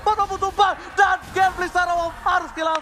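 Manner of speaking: screaming high, loud climax call; fighting game announcer. Real, not acted. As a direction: scream the teamfight finish. (teriakan komentator puncak)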